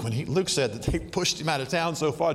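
normal talking voice

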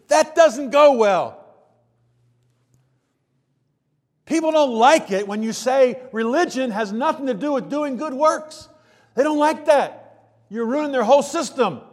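fiery pulpit emphasis; full-force preaching. Acted. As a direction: drive it home to the congregation. Preacher presses the point.

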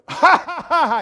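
high, loud fake "ha ha"; mock laughter. Class laughing at the professor, high mocking laughter. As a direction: ham up the class laughing along.

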